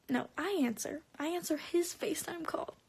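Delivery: ordinary talk, nothing marked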